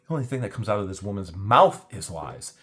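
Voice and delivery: ordinary talk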